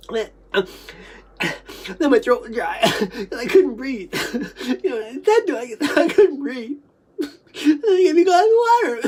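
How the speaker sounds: high, breath-caught voice with laughs; choking, tearful reliving. Retold or imitated. Recalling a dry throat before water came, high choked voice. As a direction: relive it high, struggling for air.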